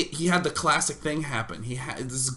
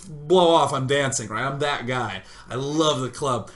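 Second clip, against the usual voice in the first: normal talking voice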